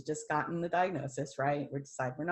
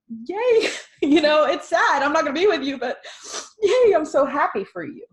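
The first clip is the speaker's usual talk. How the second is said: high, loud cheer with a laugh; celebrating; 11 semitones higher, 11 dB louder than usual. Retold or imitated. Nurse cheering for the dying, laughing celebration. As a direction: cheer them on, delighted for them. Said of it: "I looked at it as like".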